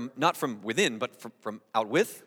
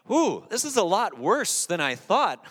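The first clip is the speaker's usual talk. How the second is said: higher, slow exaggerated realisation; mock dismayed surprise; 6 semitones higher, 4 dB louder, slower than usual. Preacher imagines Jesus shocked at the world, mock dismay. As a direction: draw it out with raised, comic dismay.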